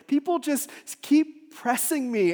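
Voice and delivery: high, slow, strained-out lament; pleading, worn-down outburst; distressed complaint. Acted. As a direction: push it out loudly in exasperation. Voices a struggling believer's complaint.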